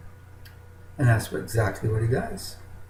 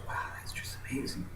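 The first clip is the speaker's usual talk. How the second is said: softer, fast, breathless whisper of wonder; awed whisper; 14 dB quieter, faster than usual. Acted. Eagle gazes at the soaring bird, whispering in awe. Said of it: "he looks and he goes".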